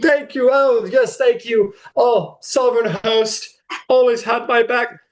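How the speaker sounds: hammed-up grateful knight; gracious champion. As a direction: acknowledge applause as the winning knight. Sir Norris accepts the applause.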